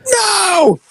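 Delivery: high, drawn-out hammed-up wail; mock horrified cry. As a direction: act out a despairing shout, stretched out. Suspect's despairing cry, retold.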